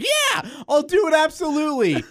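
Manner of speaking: high, excited acceptance; imitating his own eager yes. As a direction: jump at the offer like a thrilled kid. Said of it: "I was like".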